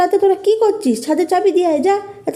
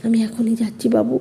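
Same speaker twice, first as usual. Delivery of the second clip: frightened hush; 9 semitones lower, 5 dB quieter than usual. Acted. Mother hurries, frightened.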